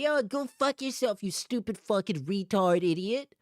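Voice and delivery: mimicked angry outburst, pitched high; hammed-up raging insult; mock rage. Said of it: "And being like".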